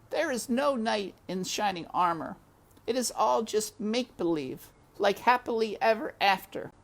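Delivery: high, despairing mouse voice. A narrator's reading. Despero despairs to himself, high and despairing. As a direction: grieve that it's all make-believe. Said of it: "said Despero".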